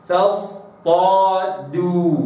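فاصطادوا slow, dal not thickened after ta; Quranic recitation. Shown as a demonstration. Teacher models فاصطادوا slowly. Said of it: "هكذا".

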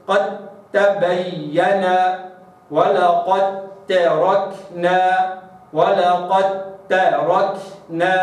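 قد تبين slow, dal merged into ta; tajweed recitation. Shown as a demonstration. Teacher models the merged dal. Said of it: "هكذا".

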